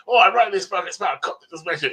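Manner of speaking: high, loud opening, rattled off fast; eager retelling. Retold or imitated. Writer telling bookshop customers about his book, mock eager. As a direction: imitate an eager pitch.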